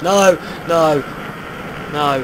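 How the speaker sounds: high, loud frightened "no!"s; real panicked shouts. Real, not acted. Panics at a mob.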